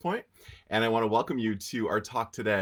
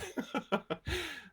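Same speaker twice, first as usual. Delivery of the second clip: high giggle; 6 dB quieter than usual. Real, not acted. Laughs at his own joke.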